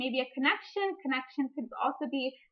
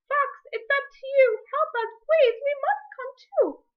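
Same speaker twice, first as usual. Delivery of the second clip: much higher, louder pleading doves; doves crying out; 13 semitones higher, 5 dB louder than usual. Acted. Caged doves begging the fox to free them, high and pleading. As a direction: beg for help in small bird voices. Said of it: "two doves cried".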